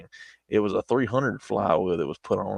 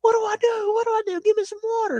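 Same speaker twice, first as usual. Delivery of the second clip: extremely high squeaky falsetto panic; panicked friend. Retold or imitated. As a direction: squeal it like a terrified cartoon. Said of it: "he was like".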